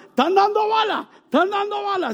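very high, urgent cry of alarm; a wife's high frantic alarm; panicked alarm. Retold or imitated. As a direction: warn everyone the shooting started. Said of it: "Y Ninfa".

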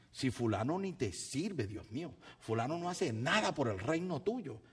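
usual speaking voice

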